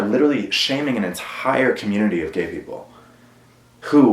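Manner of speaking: usual speaking voice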